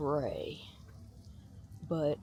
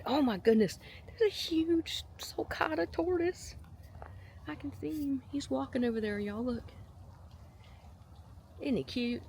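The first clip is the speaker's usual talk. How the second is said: light up at spotting the tortoise; excited; 9 semitones higher than usual. Real, not acted.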